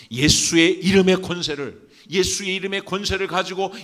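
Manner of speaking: high, loud exhortation; full-force preaching. Real, not acted. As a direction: drive it home to the congregation at the top of your voice. (힘차게 외치는 설교)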